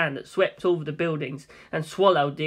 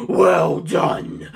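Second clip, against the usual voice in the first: laughing villain. Acted.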